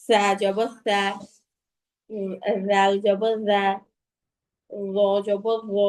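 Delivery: tongue between the teeth on each consonant; slow interdental letter drill, সা as "thaa"; tongue tip out. Shown as a demonstration. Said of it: "জিহ্বাটা একটু বের করে".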